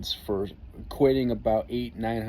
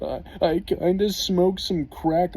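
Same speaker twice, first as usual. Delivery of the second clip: higher, slow guilty admission of a buddy; sheepish confession; 8 semitones higher, 4 dB louder, slower than usual. Retold or imitated. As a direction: admit it slowly, pitched up. Friend confessing drug use.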